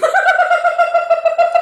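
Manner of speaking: long, loud, high laugh. Real, not acted. Co-host amused by the heat rating, loud high laugh.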